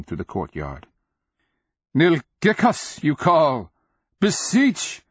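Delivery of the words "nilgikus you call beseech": high, loud oratory; ringing, raised-voice outburst; impassioned declaiming. A narrator's reading. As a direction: proclaim it from on high. Nonman declaims from above the fires.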